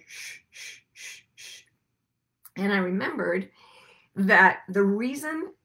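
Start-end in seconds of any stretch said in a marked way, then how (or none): 0.1-1.6 soft unvoiced shushing. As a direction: shush the baby gently. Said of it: "what I heard was".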